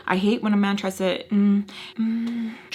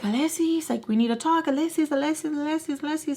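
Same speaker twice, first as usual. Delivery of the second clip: calling after; 6 semitones higher, faster than usual. Retold or imitated. Relatives calling after her.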